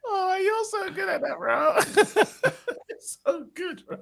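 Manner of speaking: high-pitched, helpless laughter through words; laughing. Real, not acted. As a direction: gasp it out while laughing. Cracking up at the impression.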